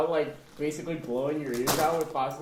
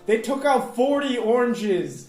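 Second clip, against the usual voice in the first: higher, louder and slower astonishment; amazed; 6 semitones higher, 5 dB louder than usual. Real, not acted. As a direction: tell it in disbelief. Amazed retelling.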